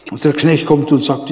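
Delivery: usual speaking voice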